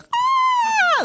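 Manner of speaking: high imitated scream; long high shriek in mock horror. Retold reaction to the fall.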